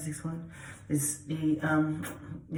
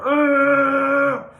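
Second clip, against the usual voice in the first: loud, high angry "ugh"; angry growl; 11 semitones higher, 12 dB louder than usual. Shown as a demonstration. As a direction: growl it out in frustration at full volume. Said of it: "So when we feel angry".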